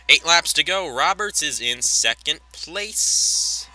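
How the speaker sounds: projected announcer call, key words drawn out; live race play-by-play. Pretend.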